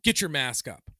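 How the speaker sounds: loud imitation of a barked order; mock yelled reprimand; retold angry shout. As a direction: shout it like the scolding custodian. Retold custodian scolding him.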